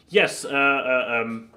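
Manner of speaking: ordinary talk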